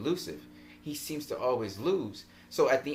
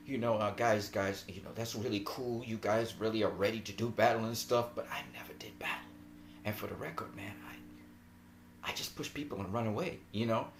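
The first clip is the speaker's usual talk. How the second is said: soft, breathless, nervous whisper; hushed panic of the rookie; scared whisper; 3 semitones lower, 7 dB quieter than usual. Retold or imitated. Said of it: "he's really panicking".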